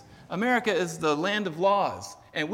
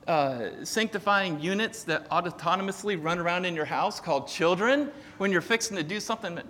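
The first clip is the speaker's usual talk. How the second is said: ordinary talk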